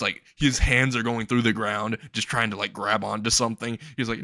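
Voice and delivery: ordinary delivery, nothing marked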